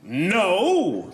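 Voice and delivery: sharp heroic no; superhero refusing. Acted. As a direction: turn her down like a superhero. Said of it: "impression of a superhero".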